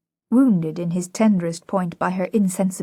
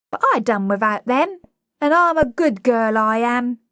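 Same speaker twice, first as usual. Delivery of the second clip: put-on loud, higher cockney girl standing up for herself; a cockney girl declaring she is leaving; louder cockney girl pushing back; defiant cockney flower girl; 5 semitones higher, 4 dB louder than usual. A narrator's reading. Liza voiced in cockney.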